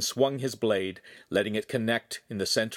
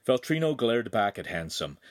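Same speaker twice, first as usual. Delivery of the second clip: ordinary delivery, nothing marked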